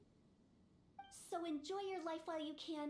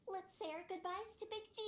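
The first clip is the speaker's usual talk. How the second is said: ordinary talk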